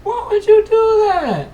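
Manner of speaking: high, loud and slow in disbelief; shocked outcry. Acted. Player crying out at the king, high and loud. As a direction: cry out at the betrayal.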